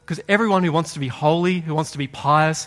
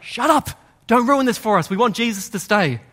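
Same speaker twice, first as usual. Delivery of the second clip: harsh rebuke; 6 semitones higher than usual. Acted. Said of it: "This is harsh, this is a rebuke".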